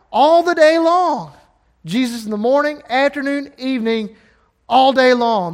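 loud, high preaching climax; preaching at full force. Acted. Preacher urging the church to love Him all day, fervent. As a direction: drive it home to the church.

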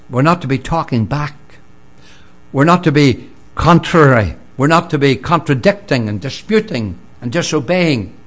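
forceful sermon emphasis on not; emphatic preaching. Acted. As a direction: drive each point home.

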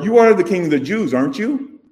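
"aren't you?" tagged on with a high rising tune; quick statement ending in a high rising "aren't you"; tag question. Shown as a demonstration. Preacher rephrasing Pilate's question, tag rising.

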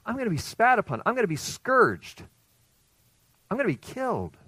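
a pleading, lifted prophetic voice; urgent warning. Retold or imitated. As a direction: make them hear the coming suffering. Preacher voicing Jesus' warning.

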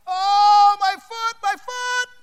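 high, hammed-up wail, loud at first; imitated crying. Boy lying on the ground holding his foot, mock crying wail. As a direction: wail like a hurt kid, overdone. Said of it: "I held my foot and I cried".

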